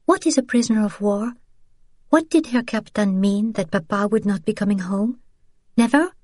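put-on a frightened girl pressing for answers; young girl, anxious. Sophie asking about her father, a worried child.

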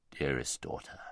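low, soft, loving lion; tender great lion. A narrator's reading. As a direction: welcome her gently, deep and quiet. Great lion tenderly greets mare.

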